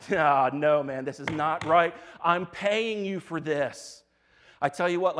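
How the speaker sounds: loud, protesting complaint; indignant Balak. Acted. King to the prophet he hired, loud indignant protest. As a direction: object loudly as the cheated employer. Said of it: "Balak is like".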